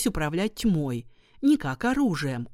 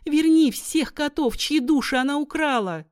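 high, loud earnest plea; pleading; 9 semitones higher, 5 dB louder than usual. Acted. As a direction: beg for the cats' souls. Maria begs the goddess.

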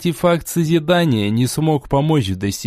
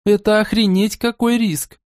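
outraged; 8 semitones higher than usual. Acted. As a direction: rant it high at him. Amelia ranting.

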